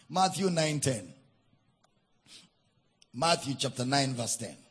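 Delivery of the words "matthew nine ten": normal talking voice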